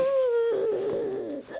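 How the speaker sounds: a high, drawn-out buzzing bee. Pretend. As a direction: buzz like an escaping bee.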